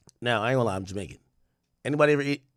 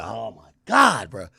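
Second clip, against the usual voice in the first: exasperated; 3 semitones lower, 4 dB louder, slower than usual. Retold or imitated. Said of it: "And I'm like".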